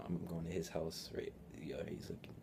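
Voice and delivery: low, furtive whisper. Retold or imitated. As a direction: keep it barely audible, as if someone's listening. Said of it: "slowly whispering".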